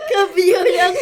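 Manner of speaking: squealing laughter through words; laughing. Real, not acted. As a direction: crack up while saying it. Both crack up laughing.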